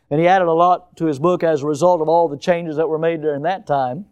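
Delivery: usual speaking voice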